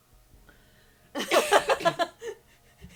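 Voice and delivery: loud shrieking laughter; loud high laugh. Real, not acted. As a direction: crack up loudly. Laughs about demon stick.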